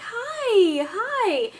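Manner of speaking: high, singsong greeting; baby-talk hello. Retold or imitated. Mom showing what she always says to baby, high baby talk. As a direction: coo hello up high to an infant. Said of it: "I'm always like".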